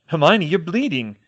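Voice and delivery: alarmed shout. A narrator's reading. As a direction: call out in alarm. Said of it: "with George's shout".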